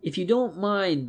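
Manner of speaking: mild plea; begging. A narrator's reading. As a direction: asks it as a favour, mildly. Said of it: "begged Willie".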